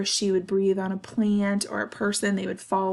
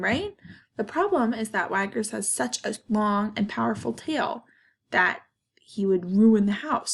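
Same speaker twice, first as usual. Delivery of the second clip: ordinary delivery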